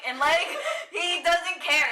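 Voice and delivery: slow, high, laughing disbelief; laughing outrage. Real, not acted.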